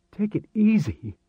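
high-pitched, indignant echo; incredulous. Acted. Chris to Marek who told him to calm down, incredulous. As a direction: throw the words back in disbelief.

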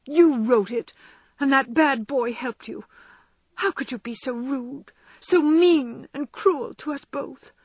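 tearful accusing reproach; reproachful. A narrator's reading. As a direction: blame her sister to her face. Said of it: "saying reproachfully".

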